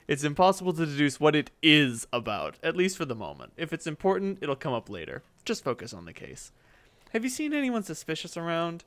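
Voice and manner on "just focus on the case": ordinary talk, nothing marked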